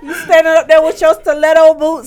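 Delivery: high, loud, laughing. Real, not acted. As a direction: describe it laughing. Laughing superhero image.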